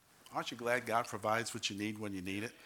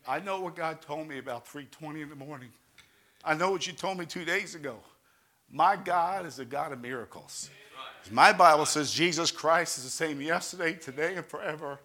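higher and much louder, rising into a shout; full-force preaching; 5 semitones higher, 11 dB louder than usual. Acted. Pastor testifying God's miracle to the church, building to a shout. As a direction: preach it out with fire.

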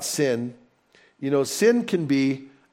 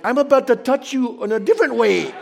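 high, loud laughing mock threat; preacher jokingly threatening the rude seatmate, laughing; 9 semitones higher, 4 dB louder, faster than usual. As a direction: joke the threat through laughter. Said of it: "the madder I was getting".

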